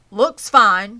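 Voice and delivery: loud, curt snap; snapped. A narrator's reading. Zuckerman snaps at his fussing wife. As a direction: brush her off curtly. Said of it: "snapped Mister Zuckerman".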